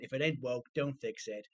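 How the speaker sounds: podcast title in a basic American accent; generic American accent. Shown as a demonstration. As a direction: introduce the show as a generic American presenter. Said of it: "root one American accent like this".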